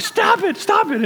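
high-pitched shouted protest; imitated scream. Brother yelling at the driver creeping up on a buffalo, imitated scream. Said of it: "he just screams".

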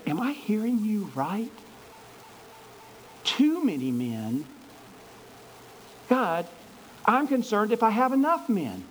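a touch of high, incredulous questioning; lightly voiced worried Gideon; disbelieving Gideon. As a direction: Gideon doubting God's order, mildly.

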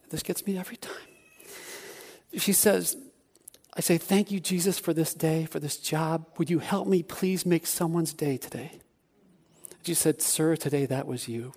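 real emotion, voice dropping soft; choked up. Real, not acted. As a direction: fight back tears as you tell it. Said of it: "I stood there just like now".

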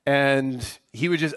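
ordinary talk, nothing marked

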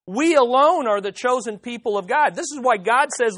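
normal talking voice

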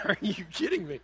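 laughing through the words; laughing disbelief. Real, not acted. Father laughing at trusting his life to girls, laughing. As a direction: chuckle it in disbelief.

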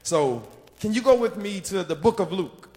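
loud, rising call to the congregation; preaching at full force. Acted. Preacher rousing the congregation to stand, loud preaching. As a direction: fire up the church for the word.

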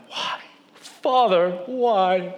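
hammed-up childlike pleading; mock anguished kids. Kids told the dog must go back, mock anguished pleading. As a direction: plead like melodramatically heartbroken children.

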